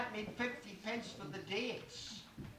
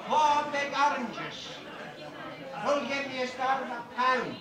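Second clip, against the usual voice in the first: auctioneer; 3 semitones higher, 5 dB louder than usual. Acted. Announces next lot loudly.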